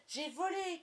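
high, thrilled shout; small boy flying for the first time; excited cry. A narrator's reading. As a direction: cry out in delight mid-flight. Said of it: "cria-t-il".

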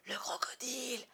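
breathless whispered alarm. A narrator's reading. Hook whispers to his bosun, terrified. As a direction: hiss it out in fear. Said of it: "souffla-t-il".

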